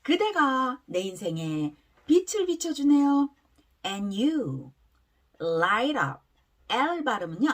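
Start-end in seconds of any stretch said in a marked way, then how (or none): 3.8-6.2 slow, separated phrases for repeating; pronunciation demo. Shown as a demonstration. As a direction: model it slowly for learners. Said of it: "발음 한번 따라해볼게요". (따라 하도록 천천히 끊어 읽는 발음 시범)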